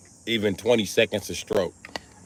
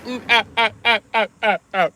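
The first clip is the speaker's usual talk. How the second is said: put-on laugh; 13 semitones higher than usual. Laughing at his own joke.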